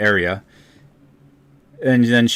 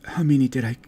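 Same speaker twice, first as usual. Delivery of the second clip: a lightly voiced stricken dryad, hushed at first; shaken dryad; 4 semitones higher, 5 dB quieter than usual. Dryad realizing her past deeds to the party, hushed and shaken.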